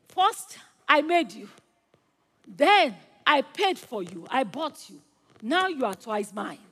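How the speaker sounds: restrained emphasis, easing down at the end; mildly emphatic declaration. Acted. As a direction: tell it to the boat with measured joy. Boy tells his boat, first telling.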